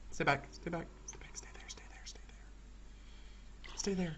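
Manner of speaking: tense whisper. Real, not acted. Gamer whispers at a nearby witch, tense. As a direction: hush it, barely breathing.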